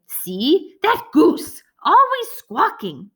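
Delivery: high, squawking little beast voice; squawky bird creature. Acted. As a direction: grumble like a cranky bird. Scorp the bird creature complains.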